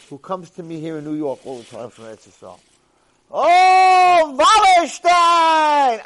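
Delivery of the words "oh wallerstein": very high, loud, drawn-out call of a name; imitating a beggar's far-carrying high call. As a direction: hail him from across the room, stretched long. Poor man calls out from afar.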